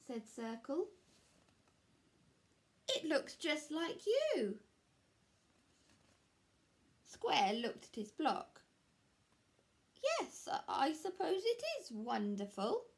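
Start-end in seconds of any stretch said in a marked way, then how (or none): 2.9-4.6 higher, slow, louder admiring line; admiring Circle. Acted. Circle praising the sculpture. 10.0-12.9 much higher, stammering unsure voice; falter, then talk yourself into it; hesitant Square. Acted. Square unsure about his work.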